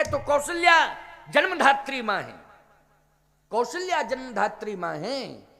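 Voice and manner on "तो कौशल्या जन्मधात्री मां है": louder, higher, with a laugh in it; emphatic declaration. Real, not acted. Preacher hammers his point to the crowd, loud and high. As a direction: proclaim Kaushalya जन्मधात्री मां है raised and loud.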